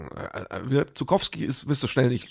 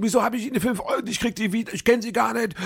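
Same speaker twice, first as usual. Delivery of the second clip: much higher, fast indignant outcry; frantic outside; 11 semitones higher than usual. Retold or imitated. Woman outside the pharmacy chasing her money, much higher fast outcry. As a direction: yelp after her at high pitch, rushing the words. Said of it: "Und dann hörte man".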